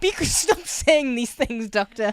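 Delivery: high, loud, slowed scolding; mock-exasperated Donna. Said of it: "She's like".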